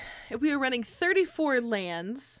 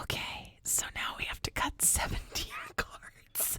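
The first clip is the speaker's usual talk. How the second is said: laughing whisper; 5 dB quieter than usual. Acted. Whispered news of more cuts.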